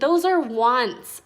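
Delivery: slow, teasing correction; amused correction. A narrator's reading. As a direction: correct them with a playful chuckle. Mia corrects Tomas, laughing.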